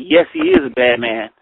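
a hint of a stern father; dad voice. Dad scolding about the Pharaoh.

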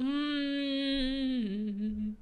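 long closed-mouth moan of frustration; frustrated groan. Real, not acted. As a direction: swallow the wail into a long hum. Cuts off angry outburst.

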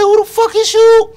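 yelled way up high; high loud retort. Retold or imitated. Storyteller replaying his own startled retort, high and loud. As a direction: snap back high and loud.

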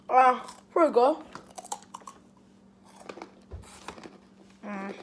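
chewing while talking; speaking between bites; mouth full of fries. Real, not acted. Seen 3: hand feeds fries to mouth, food visible in mouth while speaking.

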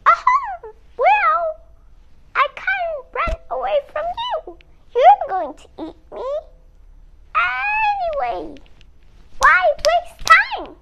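high, loud resigned donkey. Acted. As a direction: squeak out a brave donkey. Donkey speaks to the wolf.

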